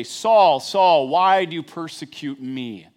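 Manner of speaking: loud, slow heavenly call; a divine voice booming down at Saul. Acted. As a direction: call his name from the sky, slow and loud.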